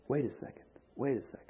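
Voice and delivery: the son catching himself, almost under his breath; hushed realization. Retold or imitated. Son in the pigsty, realizing to himself, hushed.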